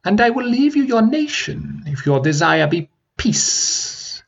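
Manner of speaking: crackling dragon laugh and offer. Acted. Dragon bargaining.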